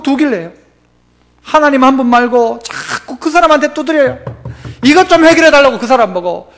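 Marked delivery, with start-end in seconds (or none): none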